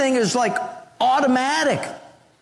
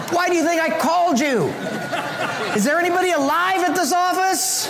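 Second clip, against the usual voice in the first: exasperated laughing shout; 8 semitones higher than usual. Retold or imitated. Caller fed up with the phone menu, shouting while laughing. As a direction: yell at the phone, cracking up. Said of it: "So I said".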